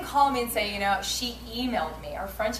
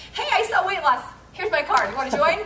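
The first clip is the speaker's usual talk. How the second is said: pushy sales pitch; 7 semitones higher, 6 dB louder than usual. Pretend. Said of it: "I don't go up in Starbucks and go".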